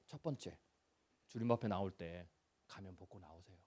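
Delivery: tender hushed pulpit confession; hushed intimate preaching. Acted. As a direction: draw them close and confess quietly. Soft intimate sermon confession.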